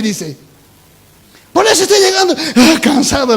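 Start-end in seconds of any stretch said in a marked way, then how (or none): 1.5-3.4 loud gasp of exhaustion; weary, panting angel. Acted. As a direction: puff out the words as if spent. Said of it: "Cansado llega". (ángel cansado, jadeando)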